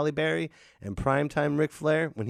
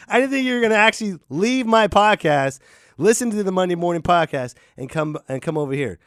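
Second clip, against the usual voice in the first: ordinary talk, nothing marked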